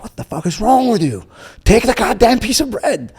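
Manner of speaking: high, loud, exasperated demand; angry outburst. Retold or imitated. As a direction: re-enact losing your temper, voice shooting up. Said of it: "I was really upset".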